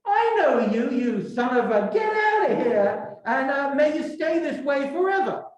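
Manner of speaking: high, heated mock tirade; angry outburst. Dante rounds on the cursed spirit who grabbed him, mock-furious. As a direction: lash out in hammed-up rage, pitch jumping.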